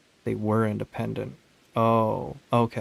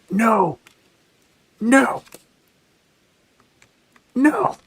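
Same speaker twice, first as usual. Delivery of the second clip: rising louder desperate no; escalating cries; 13 semitones higher, 8 dB louder, much slower than usual. Real, not acted. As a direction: cry out no, climbing higher each time. Despair as game fails.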